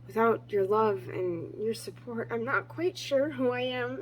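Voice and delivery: ordinary talk, nothing marked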